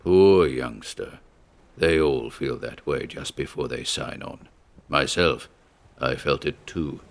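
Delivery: a deep-voiced old captain comforting a youngster; deep sympathetic voice. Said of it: "a deep voice, vibrant with sympathy".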